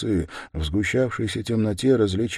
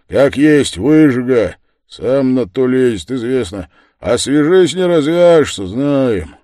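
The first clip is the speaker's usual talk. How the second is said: loud, raised-pitch dismissal; loud grumbling verdict; 6 semitones higher, 12 dB louder than usual. Acted. Big yard-keeper warns against getting tangled up, loudly dismissive. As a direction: declare it forcefully to the onlookers.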